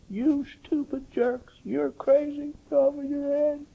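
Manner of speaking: slow, high, sneering mockery; mocking. Preacher voicing his younger self mocking Christians, sneering. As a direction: jeer at the believers, drawn out. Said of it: "these lips mocked Christians".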